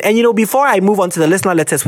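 ordinary talk

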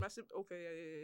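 ordinary talk, nothing marked

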